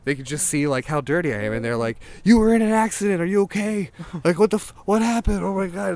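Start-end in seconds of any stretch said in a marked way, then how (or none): 2.2-3.2 loud, high imitation of panicked parents; alarmed parents. Parents see their filthy son, alarmed high shout. 3.2-3.9 high, loud worried-parent imitation; alarmed parents. Parents check on their dirty son, high alarmed shout. 4.2-5.9 loud, high imitation of panicked parents; alarmed parents. Parents see their filthy son, alarmed high shout.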